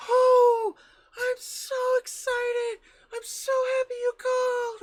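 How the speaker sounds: put-on very high, loud, drawn-out old lady; imitating an overjoyed grandmother; excited grandma impression. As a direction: gush like a thrilled grandma, hammed-up. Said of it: "I called my grandmother".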